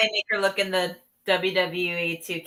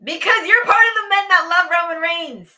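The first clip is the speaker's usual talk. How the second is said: teasing; 8 semitones higher, 5 dB louder than usual. Real, not acted. Teasing accusation.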